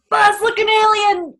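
high, loud Woody-style outburst; Woody impression. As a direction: shout it like an alarmed cowboy. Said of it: "you gotta do Woody".

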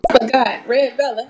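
sudden loud call to a viewer; loud shout-out. Real, not acted. Streamer remembers a viewer and shouts her name. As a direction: belt the name out.

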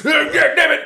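high, loud mock coach's scream; screaming without swearing. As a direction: yell it way up high like a furious rant. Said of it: "scream at people and not swear".

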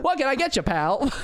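high friendly saloon barman; old-timey bartender. Pretend. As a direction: greet the customer like a saloon keeper. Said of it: "dressed like an old-timey bartender".

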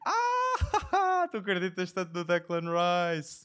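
high, drawn-out laughing tease; amused disbelief. Real, not acted. Co-host teasing a bet on West Ham, laughing in disbelief. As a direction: laugh high, then ask it slowly.

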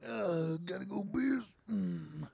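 slow mock morning grumble; groggy riser. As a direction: imitate someone heaving out of bed to pee. Acting someone getting up naked.

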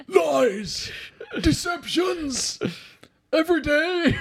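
high laughing mock shout of lies and deceptions; mock Saw Gerrera rant. Podcast host quoting Saw Gerrera to friends, laughing mock shout. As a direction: yell it through laughter like a crazed rebel. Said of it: "As Saw Gerrera".

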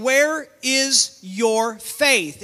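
slow, every word separately stressed; slow, emphatic question. Retold or imitated. Preacher relaying Jesus's question to believers, slow and emphatic. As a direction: press each word home with gaps.

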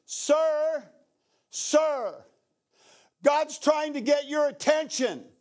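high, insistent medic's call, acted out; urgent calling out. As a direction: call to someone slipping out of consciousness. Medic urging a fading soldier.

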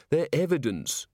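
lightly voiced tired correction; weary. A narrator's reading. Policewoman explains evidence to her colleague, weary. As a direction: point it out with mild weariness. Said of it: "she said wearily".

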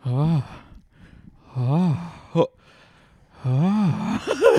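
drawn-out moaning groans. Retold or imitated. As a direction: groan slowly in the heat. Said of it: "like, moaning".

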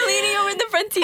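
high, squealed words through laughter; real laughing fit; helpless laughter. Real, not acted. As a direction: barely get it out for laughing. Laughing fit while retelling.

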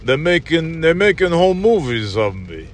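slurred, mumbling aging action star; marble-mouthed old movie-hero grumble; Sylvester Stallone. Acted. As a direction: mumble it on repeat like a befuddled tough guy. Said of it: "Stallone is just like".

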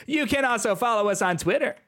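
high-pitched comic plug; John Mulaney. Acted. Podcaster plugs the show's Twitter, high comedian impression. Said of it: "I'm doing the John Mulaney voice".

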